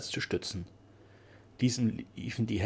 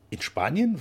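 higher, louder, startled echo; surprised question; 7 semitones higher, 5 dB louder, slower than usual. A narrator's reading. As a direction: ask it back in sudden surprise. Duke reacts to surprising news.

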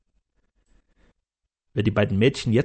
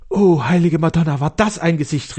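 louder, higher rapturous cry; enthusiastic; 4 semitones higher, 5 dB louder than usual. Acted. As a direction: gush like a smitten old servant. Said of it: "rief der Kastellan ganz enthusiastisch".

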